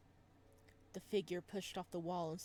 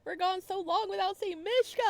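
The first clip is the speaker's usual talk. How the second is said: complaining whine; 12 semitones higher, 11 dB louder than usual. Retold or imitated. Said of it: "I was like".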